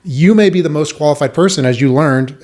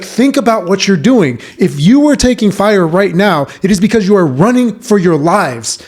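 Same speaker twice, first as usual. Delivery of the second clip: higher, louder angry lecture; yelling sergeant; 6 semitones higher, 4 dB louder than usual. Retold or imitated. Sergeant lecturing privates at a live fire, loud angry. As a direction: drive the lesson home at full volume. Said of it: "he's, like, yelling at us".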